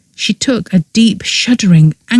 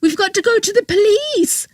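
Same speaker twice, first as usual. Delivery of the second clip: very high mocking quote; higher voiced mocking of Lily's panic; quoted Lily; 4 dB quieter than usual. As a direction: mimic Lily, shrill and panicked. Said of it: "She said".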